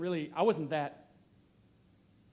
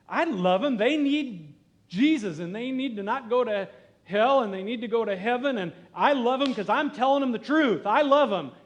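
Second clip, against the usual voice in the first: louder, higher, defensive insistence; indignant protest; 6 semitones higher, 7 dB louder than usual. Retold or imitated. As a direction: protest that you do love them. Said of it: "my answer was".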